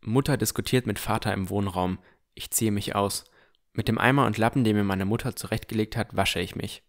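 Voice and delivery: plain reading voice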